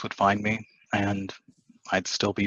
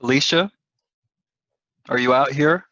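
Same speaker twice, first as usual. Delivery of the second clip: louder and higher, calling across the house; 6 semitones higher, 7 dB louder than usual. Acted. James calling Alicia from the door, louder and higher. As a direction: call for her from the door.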